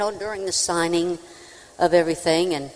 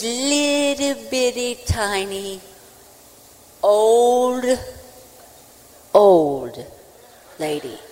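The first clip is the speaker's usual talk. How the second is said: LITTLE BITTY stretched slow and high; OLD held long and loud, both times, with pauses between; drawn-out emphasis; 5 semitones higher, 3 dB louder, much slower than usual. Retold or imitated. Singer describing the first fan, OLD stretched out for comic emphasis.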